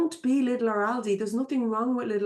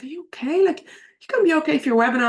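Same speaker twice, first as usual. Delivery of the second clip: higher, louder caring question; husband asking if she's fit to work; concerned husband; 5 semitones higher, 5 dB louder, faster than usual. Retold or imitated. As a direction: check on her before the webinar.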